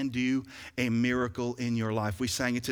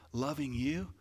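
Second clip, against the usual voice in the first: normal talking voice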